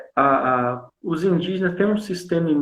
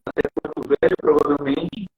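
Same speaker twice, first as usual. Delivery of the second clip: breaking up over a bad internet call; choppy call audio; 3 dB quieter, faster than usual. Real, not acted. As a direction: voice dropping in and out on a weak connection. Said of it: "tá cortando só".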